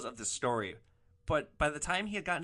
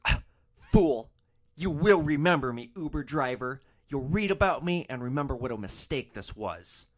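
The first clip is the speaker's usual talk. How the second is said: loud, theatrical mock fury; hammed-up, indignant proclamation; furious parting threat; 7 dB louder than usual. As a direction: play a scorned man vowing you'll regret this. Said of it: "there's fury in his eyes".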